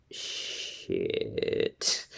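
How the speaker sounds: long, slow, very soft whisper; inner-thought whisper. Retold or imitated. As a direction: imitate a stunned private swear. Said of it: "said that in their mind".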